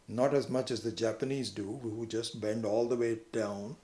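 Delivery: normal talking voice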